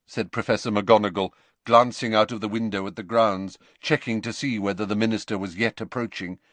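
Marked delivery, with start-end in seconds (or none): none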